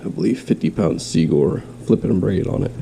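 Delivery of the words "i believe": usual speaking voice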